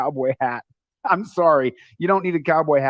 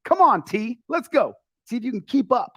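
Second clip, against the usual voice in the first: higher-pitched urging, as retold; lightly acted challenge to a buddy; quoting himself; 8 semitones higher than usual. Telling his friend to keep up on stairs, higher urging voice. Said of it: "And I'd be like".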